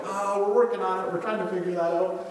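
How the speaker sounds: loud, high flustered reply; hammed-up frantic protest; mock exasperation. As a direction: imitate harried leaders under pressure. Harried leaders answering tough question.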